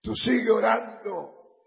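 loud, fervent sermon with shouts; fiery preaching. Acted. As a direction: preach it out to the crowd. Predicación a pleno pulmón. (predicación ferviente a gritos)

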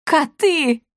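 high-pitched outraged bellow; madam's booming outcry; thunderous roar. A narrator's reading. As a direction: roar it out in alarm, pitched well up. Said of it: "раздался громогласный рёв".

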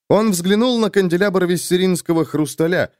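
usual speaking voice

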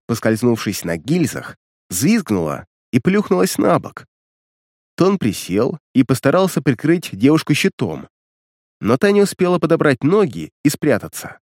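ordinary delivery

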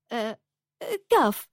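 hesitate, then give it up shyly; hesitant. A narrator's reading. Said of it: "قالت بعد تردد". (بتردد وخجل)

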